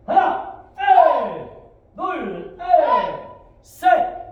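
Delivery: high, explosive counting yell; drill command shout. Real, not acted. Instructor calling kicks for students, shouting. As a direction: bellow the count to drive the class.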